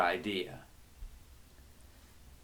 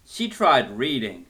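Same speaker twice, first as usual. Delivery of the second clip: ordinary delivery, nothing marked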